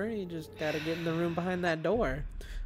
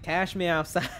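Cash me outside meme; 4 semitones higher, 6 dB louder than usual. Retold or imitated. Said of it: "And then the chorus all like".

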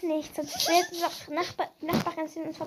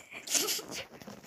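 stifled breathy giggle; suppressed giggles; 5 semitones higher, 7 dB quieter than usual. Real, not acted. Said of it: "Lach nicht so".

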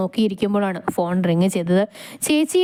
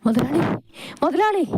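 high, panting call; out of breath; 7 semitones higher than usual. Acted. Servant runs up calling his boss, out of breath. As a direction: call out between gasps after running. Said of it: "ഓടിക്കതച്ചുകൊണ്ട്".

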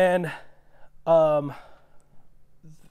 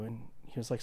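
ordinary talk, nothing marked

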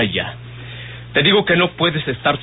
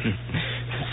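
soft teasing laugh; 4 semitones lower, 11 dB quieter than usual. Acted. Mocking her jealousy.

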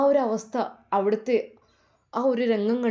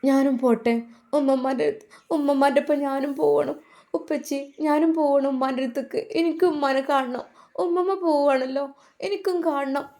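put-on high child's voice, a touch of tearful pleading; a small girl begging through mild sobs, pitched high; sobbing little girl; 6 semitones higher than usual. A narrator's reading. Grieving child begs to follow her grandmother, high and lightly tearful. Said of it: "ആ കുഞ്ഞ് വിതുമ്പി കരയുകയാണ്".

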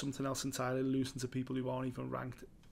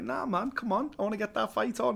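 higher, lightly voiced fighter; imitating Moicano; 7 semitones higher than usual. Retelling Moicano's reply to co-host, lightly imitated and higher. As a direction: do the fighter brushing it off. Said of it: "And he was like".

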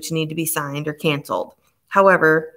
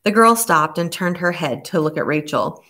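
ordinary delivery, nothing marked

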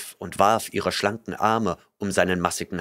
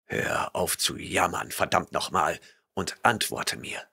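lower, softer contemptuous warrior; stern barbarian rebuking a liar; gruff barbarian; 3 semitones lower, 5 dB quieter than usual. A narrator's reading. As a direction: rumble your disgust. Conan's voice.

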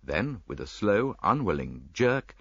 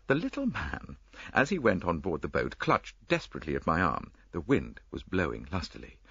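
ordinary talk, nothing marked

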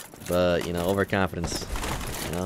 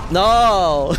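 loud dismayed no; 8 semitones higher, 7 dB louder than usual. Real, not acted. Dying in a game.

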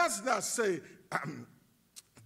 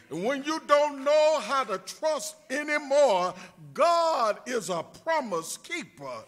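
building sermon call, louder each line; rising preaching cadence; 3 semitones higher, 5 dB louder than usual. Acted. Pastor rousing the congregation, building preaching cadence. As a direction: preach it up toward the light.

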